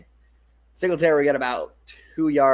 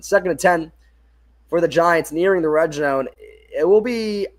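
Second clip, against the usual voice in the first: sportscaster setting up the down, a touch louder; play-by-play call; 3 semitones higher, 5 dB louder than usual. Acted. Setting the scene near the red zone, broadcaster call. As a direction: announce down and distance like TV.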